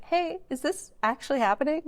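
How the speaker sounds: high, hopeful question; high-pitched acted-out email query; tentative excited inquiry. Quotes own hopeful email.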